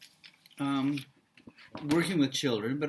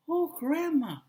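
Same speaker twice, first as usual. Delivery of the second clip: put-on high sweet little girl; 11 semitones higher, 5 dB louder than usual. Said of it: "it's kind of high and sweet".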